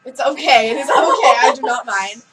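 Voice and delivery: loud laughing protest; real laughter while brushing off the insult. Real, not acted. Laughing off friend's teasing.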